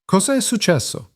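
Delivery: sudden high-pitched alarm; alarmed question. Acted. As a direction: ask in a frightened rush, voice jumping up. Panicked question about grandmother.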